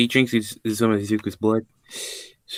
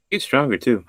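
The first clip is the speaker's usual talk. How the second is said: ordinary delivery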